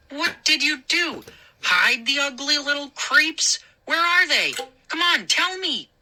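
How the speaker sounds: high-pitched, heated bullying demand; angry interrogation. Acted. Moose grilling the boy about hidden gnomes, angry and high. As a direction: push each question harder, high and insistent.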